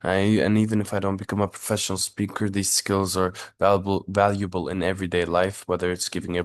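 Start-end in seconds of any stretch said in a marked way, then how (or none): none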